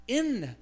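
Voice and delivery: "in" loud, high and falling; emphatic, isolated "in"; stress on IN. Shown as a demonstration. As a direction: hit "in" hard on its own. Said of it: "Not for, but in".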